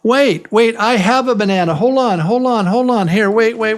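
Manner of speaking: high, loud burst of excitement; excited urgency. Pretend. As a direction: call out urgently to hold everyone's attention. Acted excited rush for bananas.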